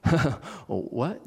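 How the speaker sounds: mildly nervous laughing, imitating Peter; thrown, uneasy half-laugh. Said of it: "Peter sort of nervously laughing".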